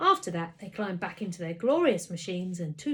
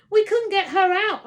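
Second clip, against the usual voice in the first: high, loud blurted interruption; boy blurting an excuse to his mum; butting in; 12 semitones higher, 15 dB louder than usual. A narrator's reading. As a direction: cut in urgently. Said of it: "I butted in".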